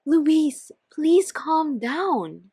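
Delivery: high, loud and very slow plea; pleading. Acted. Andrea pleading with furious Louise, high and slow. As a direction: beg her to calm down.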